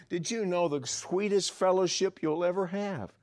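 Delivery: preaching. Acted. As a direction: build the sermon to a passionate push.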